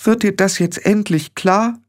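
high-pitched pleading demand; upset wife insisting; tearful exasperation. A narrator's reading. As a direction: push the question out, pitched high.

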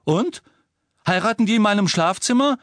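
höher, lauter und schneller; aufgebracht. Acted. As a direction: sarkastisch und empört nachhaken. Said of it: "fragte Ron aufgebracht". (Ron hakt bei der Mutter nach, aufgebracht)

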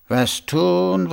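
pondering, pitch lifted on tun; thoughtful. A narrator's reading. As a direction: turn the problem over aloud. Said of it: "sagte er nachdenklich".

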